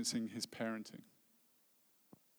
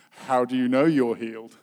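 loud, doubting question; incredulous challenge; 11 dB louder than usual. Retold or imitated. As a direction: demand proof, loudly. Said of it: "was going".

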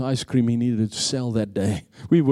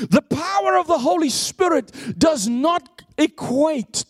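preaching shout; 13 semitones higher than usual. Real, not acted. Peak of the sermon.

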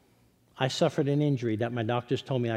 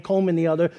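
ordinary talk

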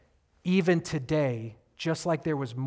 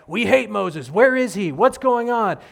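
loud, raised-pitch angry crowd; mock outrage; 3 semitones higher, 6 dB louder than usual. Israelites turning on Moses moments later, mock outrage. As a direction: complain loudly like a fickle mob.

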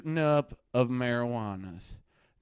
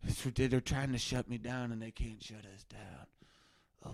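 normal talking voice

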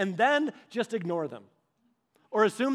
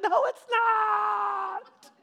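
high, loud, slow drawn-out wail with a laugh; adult tantrum; 13 semitones higher, 5 dB louder, much slower than usual. Retold or imitated. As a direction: wail it like an overgrown toddler. Us melting down at God.

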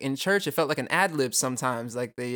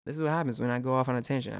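ordinary delivery

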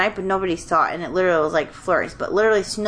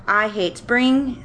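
"I hate spring" drawn out slowly and higher; all-caps emphasis; 5 semitones higher, much slower than usual. Retold or imitated. Reading a friend's all-caps spring complaint, emphatic. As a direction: stretch and stress each word of "I hate spring". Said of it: "this is all capital".

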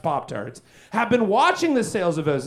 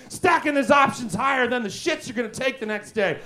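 usual speaking voice

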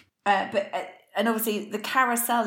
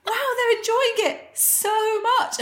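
slow, high, wide-eyed wonder; drawn-out gushing marvel at the Hall of Mirrors; mock amazed; 12 semitones higher, 4 dB louder, much slower than usual. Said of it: "I was like".

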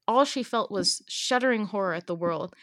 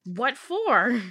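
much higher, much louder mock outraged shout; mock yell; 8 semitones higher, 9 dB louder than usual. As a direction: blast it out in a hammed-up shriek. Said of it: "This is what I'm reading".

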